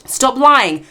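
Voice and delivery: normal talking voice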